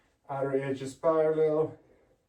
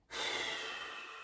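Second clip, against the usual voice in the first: soft sigh; 8 dB quieter than usual. Real, not acted. Exhale during stretch.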